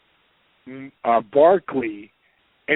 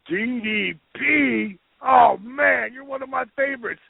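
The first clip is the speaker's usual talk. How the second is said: higher, drawn-out "D D P", then a quick gush; imitating a fan star; 9 semitones higher than usual. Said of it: "and I hear".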